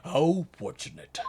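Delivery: fortunate read in the judge's voice. Acted. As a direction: correct line How fortunate, judge voice. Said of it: "I was gonna say".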